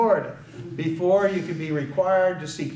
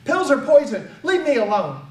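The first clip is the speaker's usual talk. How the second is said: loud, high shout pushing the father away; retold scream; 7 semitones higher, 6 dB louder than usual. Son screams at his father in the car, loud and high. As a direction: yell it like a frightened son refusing the pills. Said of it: "and he screamed".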